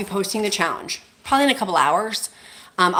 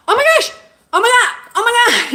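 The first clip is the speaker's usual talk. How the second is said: real excited squeal; 8 dB louder than usual. Real, not acted. Overwhelmed by viewers' kindness.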